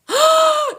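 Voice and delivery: a high, hammed-up gasp of shock; mock gasp. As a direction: gasp as if scandalised.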